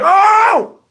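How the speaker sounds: very high, long group howl; drawn-out high holler; crowd "oh!". Retold or imitated. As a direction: imitate kids reacting to a big leap. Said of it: "we was like".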